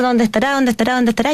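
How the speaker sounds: anxious searching. Retold or imitated.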